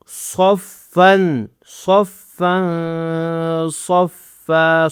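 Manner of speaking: صَفًّا with thick "s" and long nasal "n"; heavy saad, ghunnah. Shown as a demonstration. Teacher drilling the word, slow nasalised صَفًّا. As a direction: thicken the start of صَفًّا and hum its ending. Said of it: "فا پر غنہ کرنا ہے".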